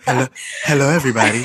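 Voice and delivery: smooth late-night commercial voice; lightly mocking announcer greeting. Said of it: "Sound like them voices on them commercials".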